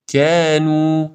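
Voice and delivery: light drawn-out a in کنو; correct thin vowel. Shown as a demonstration. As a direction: stretch کنو with a fronted a. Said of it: "کنو درست".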